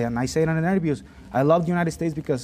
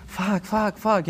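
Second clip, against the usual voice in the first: alarmed friends; 6 semitones higher, much slower than usual. Retold or imitated. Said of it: "were like".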